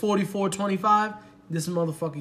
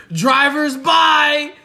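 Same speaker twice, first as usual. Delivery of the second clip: loud, high, drawn-out mock holler; mock announcement; 8 semitones higher, 10 dB louder, much slower than usual. Date sliding the check to her, slow mocking high holler. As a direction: stretch it out loudly, imitating the date.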